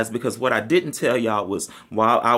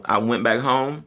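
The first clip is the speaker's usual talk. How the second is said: ordinary talk, nothing marked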